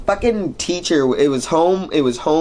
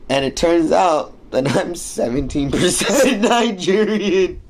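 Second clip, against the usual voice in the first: laughing; 6 semitones higher than usual. Real, not acted. Laughs at own irony.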